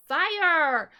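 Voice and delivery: put-on high, loud childlike spell shout; determined shout. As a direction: put everything into the spell word. Child tries spell again.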